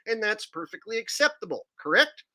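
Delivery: ordinary talk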